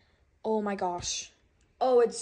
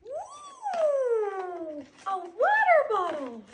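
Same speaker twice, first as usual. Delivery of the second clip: excited squeal; 11 semitones higher, 5 dB louder, much slower than usual. Real, not acted. Kid unboxing a surprise gift.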